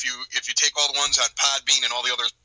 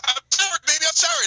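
pleading husband; 13 semitones higher than usual. Retold or imitated. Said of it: "and he goes".

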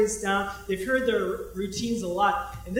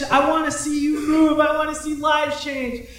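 higher, louder calling out to God; a retold pleading prayer, raised and loud; 6 semitones higher, 8 dB louder than usual. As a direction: cry out the prayer with longing.

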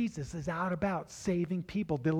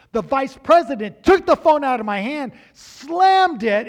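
heated retelling; 7 semitones higher, 14 dB louder than usual. Retold or imitated. Retelling angry vice president.

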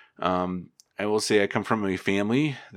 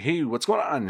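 high, upbeat hello to a buddy; lightly acted cheerful hey; friendly greeting; 9 semitones higher than usual. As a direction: greet him like an old friend. Greeting a known caller.

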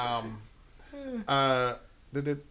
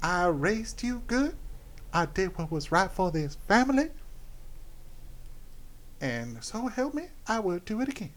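hammed-up Alabama accent; 5 semitones higher, 4 dB quieter than usual. Madison justifying herself to the family, Alabama accent. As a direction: draw it out in a put-on Southern Alabama voice. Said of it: "probably a horrible Alabama accent".

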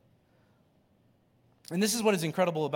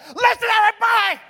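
high, loud, fast shout; screaming; 14 dB louder, faster than usual. Retold or imitated. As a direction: scream it at the whole crowd. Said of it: "He screams at the people".

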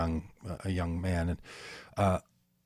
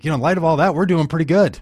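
higher, louder joking lift; wry punchline; 8 semitones higher, 8 dB louder, faster than usual. Real, not acted. Ending a heavy thought with a joke, higher and louder. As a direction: land the joke with a bright push up.

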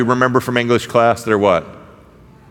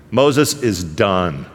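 slow emphatic; 7 semitones lower, much slower than usual. Real, not acted. Punchline about Moses.

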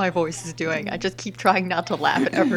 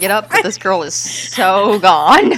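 whiny grumble; 8 dB louder than usual. Retold or imitated. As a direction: grumble it from the table. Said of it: "watching this all from the table going".

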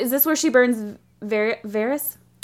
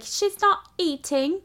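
higher, slow child imitation; hint of a little girl, pitched up and drawn out; lightly voiced little girl; 7 semitones higher, 3 dB quieter, slower than usual. Retelling the little girl's report, lightly childlike. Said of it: "With the little girl comes in".

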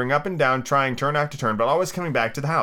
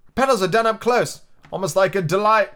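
Rose; 8 semitones higher, 4 dB louder than usual. Acted.

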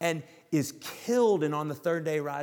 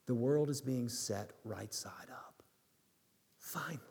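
whispered; 2 semitones lower, 7 dB quieter than usual. Real, not acted. Preacher drops to a hush.

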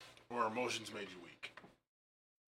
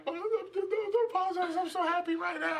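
high, laughing mock girlfriend voice; 5 dB louder than usual. Girlfriend reassuring him through happy tears, high laughing voice. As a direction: squeal it out like his happy crying girlfriend. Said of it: "And she's like".

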